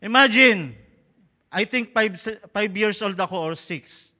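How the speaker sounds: usual speaking voice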